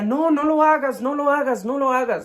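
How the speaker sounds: imitating a father's alarmed yell, pitched high; high, urgent retold cry of a panicked dad; retold frantic yell. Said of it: "él empezó a gritar".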